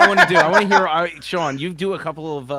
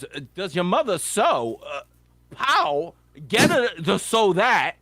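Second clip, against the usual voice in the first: higher pitched Walken imitation with pauses; Walken Joe Dirt line; 6 semitones higher than usual. Quoting Walken's Joe Dirt line, in a higher-pitched, pausing imitation. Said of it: "he goes".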